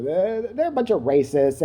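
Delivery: ordinary talk, nothing marked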